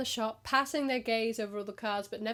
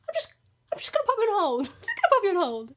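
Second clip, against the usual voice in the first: much higher, louder, fast panicked gabble; panicked repeat; 10 semitones higher, 7 dB louder, faster than usual. Retold or imitated. Agent desperately putting caller on hold, high and frantic. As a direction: blurt it in a flustered panic. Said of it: "then you're like".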